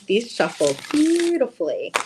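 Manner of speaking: ordinary delivery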